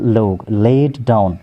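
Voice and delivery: ordinary talk, nothing marked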